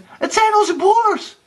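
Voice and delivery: very high, excited shout; jubilant calling. A narrator's reading. Anna recognises her brothers, calling out excitedly. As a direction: call the good news down. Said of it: "riep ze een ogenblik later uit".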